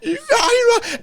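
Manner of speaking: very high, garbled imitation of yelling; spray out a high-pitched mock rant; mock raging yell. Imitating a furious driver through the window, mock yell. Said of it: "That's how much he's yelling".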